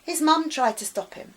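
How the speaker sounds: plain reading voice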